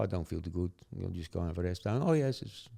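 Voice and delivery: usual speaking voice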